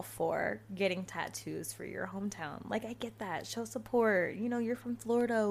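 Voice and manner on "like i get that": ordinary talk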